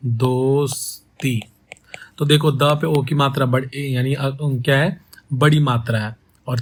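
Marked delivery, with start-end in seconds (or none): 0.1-1.4 दोस्ती whispered very slowly, syllable by syllable; whispered slow demo. Shown as a demonstration. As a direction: breathe दोस्ती out slowly to show its beats. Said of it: "अगर ये दोस्ती हो जाए". (दोस्ती को फुसफुसाकर, धीरे-धीरे, हर अक्षर अलग)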